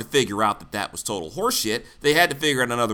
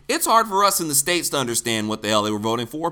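usual speaking voice

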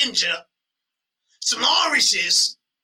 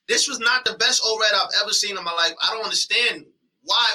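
normal talking voice

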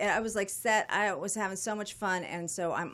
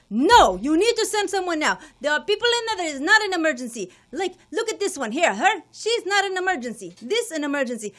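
high, loud outraged rant; hammed-up husband berating the nurse; indignant man yelling; 11 semitones higher, 11 dB louder than usual. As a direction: play the busybody stranger demanding help at the top of his voice. Stranger demanding nurse help her.

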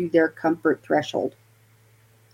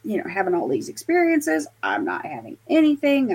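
higher-pitched mild mock complaint; bummed out; 7 semitones higher than usual. Investigator missing out on ghost experiences, mildly mock bummed out. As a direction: retell feeling left out, pitch raised. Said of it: "getting kind of bummed out".

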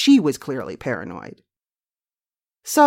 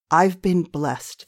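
ordinary delivery

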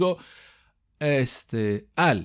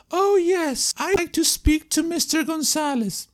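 much higher caller voice; caller asking for someone; 13 semitones higher, slower than usual. Pretend. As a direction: play a caller asking for Mr González. Caller in phone role-play.

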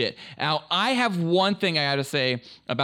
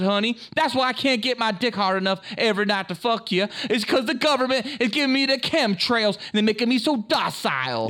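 put-on Southern accent; 10 semitones higher than usual. Host mocks believers in chemtrail theory, indignant Southern accent. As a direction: protest in an exaggerated Southern accent. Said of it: "do a Southern accent".

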